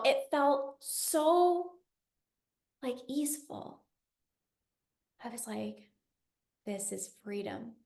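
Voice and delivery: near-silent, drawn-out wonder; soft hushed reverie over freedom; hushed awe. Real, not acted. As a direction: let the words fade to almost nothing, in awe. Blissful hushed realization.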